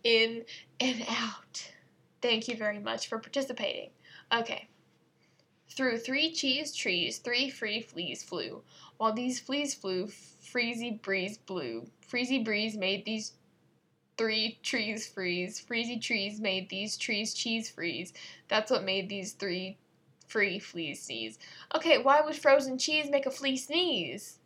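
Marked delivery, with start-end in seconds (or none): 0.0-1.7 slow whispered in and out; slow soothing whisper; whispered breath cue. Shown as a demonstration. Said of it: "I need you to just breathe with me". 5.7-21.4 tongue twister. Shown as a demonstration. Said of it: "try really hard not to giggle".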